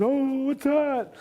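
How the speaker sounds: very high, drawn-out mimicked alarm; frightened cry. Sister reacting to the sudden roar, imitated frightened cry. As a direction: imitate a scared sister's slow wail.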